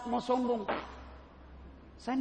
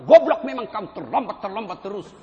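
mock angry cursing, loud at the start; memaki; 10 dB louder than usual. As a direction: explode at a student who is always late. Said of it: "coba kalau dimaki-maki". (memaki mahasiswa yang terlambat)

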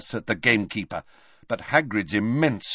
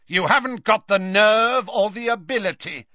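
higher, scornful taunt; Snape; 7 semitones higher, 4 dB louder than usual. A narrator's reading. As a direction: sneer it out in a raised voice. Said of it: "could see him sneering".

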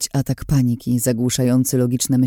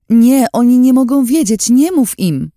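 much higher, much louder panicked cry; loud desperate shout; 11 semitones higher, 8 dB louder than usual. A narrator's reading. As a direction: scream it to stop him. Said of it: "krzyknęłam głośno".

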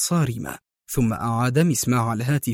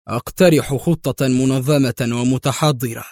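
usual speaking voice

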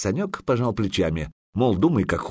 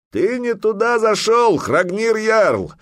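loud, high bellow across the fjord; shouted challenge; 13 semitones higher, 5 dB louder, slower than usual. A narrator's reading. Old warrior bellowing at the jarl across the fjord, loud. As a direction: roar it at the enemy jarl. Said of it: "проорал седобородый вояка".

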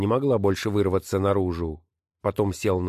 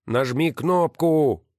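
slow, a bit higher call; shouting; 7 semitones higher, 3 dB louder, slower than usual. Acted. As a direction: call it out to the wife inside. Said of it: "закричал он". (громко и медленно, окрик)

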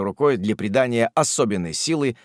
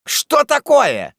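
high, loud, drawn-out query; slow, astonished raised voice; startled question; 14 semitones higher, 7 dB louder, much slower than usual. Acted. Host startled by guest's cry.

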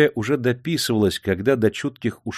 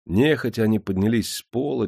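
plain reading voice

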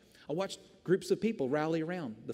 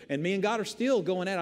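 ordinary talk

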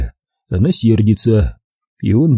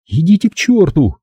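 higher-pitched, defiant brush-off; high, hostile retort; hostile captive woman; 7 semitones higher than usual. A narrator's reading. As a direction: send him away in a raised woman's voice. Hostile captive woman rebuffs him.

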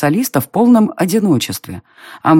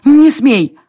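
high, louder forbidding shout; panicked cry; 10 semitones higher, 5 dB louder than usual. A narrator's reading. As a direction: burst out in alarm to stop someone. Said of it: "Я запаниковала". (громкий панический запрет)

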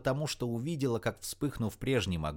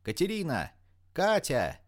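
higher, insistent calling; 7 semitones higher, 3 dB louder than usual. A narrator's reading. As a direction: call the friend's name up to a window. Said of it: "закричала она".

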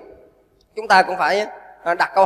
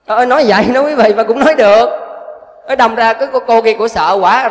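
exasperated exclaim; 3 semitones higher, 6 dB louder than usual. Real, not acted. Monk exclaims at the absurd advice.

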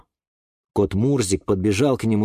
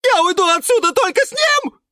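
much higher, loud squeal; unexpectedly high squeal; 5 dB louder than usual. Acted. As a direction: shriek the vow in a high voice. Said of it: "взвизгнул Юра неожиданно высоким голосом". (неожиданно высокий визг)

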